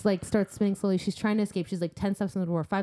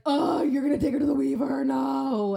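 mock dismay; 5 semitones higher than usual. Friends protesting the plan to visit the Weaver, mock dismay. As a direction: imitate the whole group's dismayed objection. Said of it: "everyone's like".

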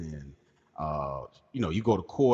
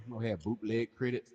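usual speaking voice

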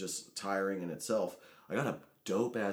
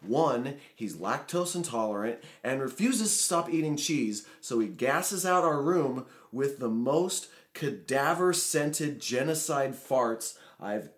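loud, high, emphatic complaint; ranting; 5 semitones higher, 5 dB louder than usual. Real, not acted. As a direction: lay out the grievance with comic exasperation. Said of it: "I need to go on a rant".

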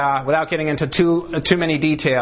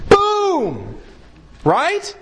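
emphatic boom; 12 semitones higher than usual. Real, not acted. Preacher to congregation after the verse, triumphant. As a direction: slam the point home.

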